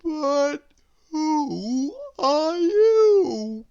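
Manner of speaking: high, very slow drowsy voice; Mister Lazy, just woken, asking slowly; sleepy Lazy. A narrator's reading. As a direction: drag the words out half asleep.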